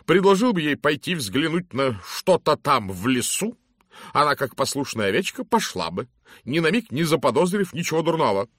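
loud, hearty lady's greeting; booming matron. Acted. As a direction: hail him like a bossy matron. Mrs Masterton theorises. (громкий зычный голос дамы)

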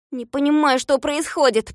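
plain reading voice

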